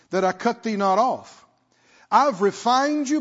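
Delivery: forceful scripture reading. Real, not acted. Preacher reads God's words from Isaiah, forceful proclamation. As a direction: proclaim it phrase by phrase, stretching key words.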